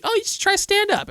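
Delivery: very high-pitched imitation of encouragement; mock well-meaning friends. Quoting people who push stand-up on him, mock high voice. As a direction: mimic everybody urging you, way up high. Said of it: "everybody says you should".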